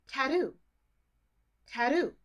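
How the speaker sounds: tattoo with a crisp T in the middle, Australian style; the Australian tattoo, clear T. Retold or imitated. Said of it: "Australians say the word".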